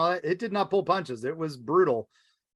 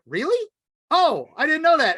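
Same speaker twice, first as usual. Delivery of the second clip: much higher, squeaky startled doctor; mock shocked, louder on the second line; flustered surprise; 11 semitones higher, 6 dB louder than usual. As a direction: jump up in pitch like a caught-out nervous man. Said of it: "and he goes like".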